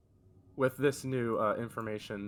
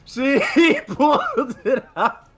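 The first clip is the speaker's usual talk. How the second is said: real laughing, high, loud shout; laughing exclamation; 15 dB louder, slower than usual. Real, not acted. Friend reacting to the rare ability, laughing loudly. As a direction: cry it out slowly through laughter.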